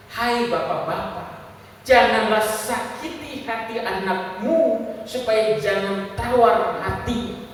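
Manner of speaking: very slow, loud, drawn-out words; scripture proclamation. Real, not acted. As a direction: proclaim it to every father, word by word. Membacakan ayat sebagai seruan kepada jemaat. (membacakan ayat dengan lantang dan lambat)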